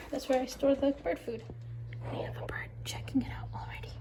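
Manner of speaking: hushed unvoiced whisper. Real, not acted. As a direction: whisper so the bird stays. Whispering near the birds.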